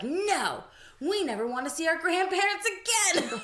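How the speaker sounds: high, loud mock refusal; tough defiant child. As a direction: imitate a little kid's fierce protest. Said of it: "being the little tough badass she is".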